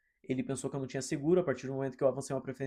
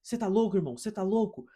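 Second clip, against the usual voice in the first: higher, pushy confrontation; imitating a guy picking a fight; a touch of an angry driver pressing him; mock aggressive challenge; 5 semitones higher than usual. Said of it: "o cara já veio tentando pôr pressão em mim".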